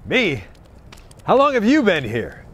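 ordinary delivery

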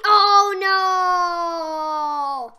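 loud crying-out oh no; dismayed shout. Real, not acted. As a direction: wail it as you lose. Dying in game.

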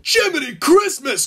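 high, loud startled exclamation; alarmed cry. A narrator's reading. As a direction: cry out in sudden panic. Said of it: "cried the big Diesel".

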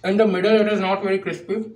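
chewing between words; eating while talking; mouth full of pizza. Real, not acted. Seen 1: Bit the slice at -3s, mouth still working while speaking.

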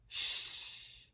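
normal talking voice

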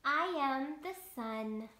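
lightly voiced slow storybook sun; sun character. Reading the sun's lines to children, lightly voiced. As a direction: read it slow and kind as the sun.

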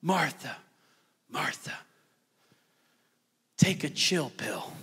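soft, fading, gently calming Martha; soothing Jesus. Acted. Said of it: "And Jesus says".

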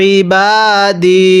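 ইবাদি very slowly, দি drawn out; slow recitation. Shown as a demonstration. Teacher recites for students, very slow. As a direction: stretch দি in ইবাদি. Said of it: "কিভাবে পড়ব".

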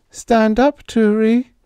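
put-on high, loud, trembling call of a girl; quavering call. A narrator's reading. Babs calling.

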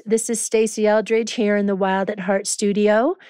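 ordinary talk

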